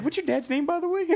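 squeaky high-pitched mock question; mock high shout. Friend mocks a nosy stranger in a high squeak. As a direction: pipe it up high like a clueless stranger. Mocking the awkward clinic chat.

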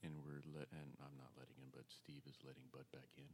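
hushed golf commentator narration; quiet play-by-play of the dog. Acted. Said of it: "I start talking like a golf commentator".

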